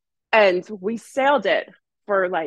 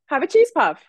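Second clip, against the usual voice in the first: put-on promo girl voice; 7 semitones higher, 5 dB louder than usual. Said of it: "you're like".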